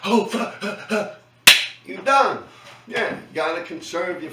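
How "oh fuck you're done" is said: panicked gasps for air; gasping. Pretend. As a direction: gasp like out of breath. Out of breath, train coming.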